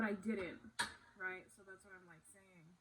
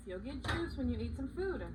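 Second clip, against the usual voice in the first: higher, louder parental reassurance; 4 semitones higher, 5 dB louder than usual. Real, not acted. Parent promising a child juice after food, higher child-directed voice.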